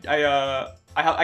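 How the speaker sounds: usual speaking voice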